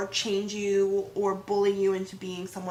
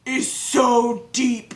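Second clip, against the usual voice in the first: slow, drawn-out imitation of a lowered voice; dropped voice; 5 dB louder, much slower than usual. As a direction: drop the voice for emphasis, drawing it out. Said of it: "drop her voice".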